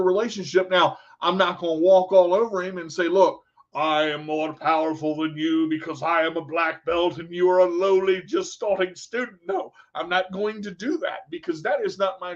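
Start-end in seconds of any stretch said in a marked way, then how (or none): 3.1-9.4 level, self-important mock boast; mock pompous. Instructor lording it over a new karate student, mock pompous.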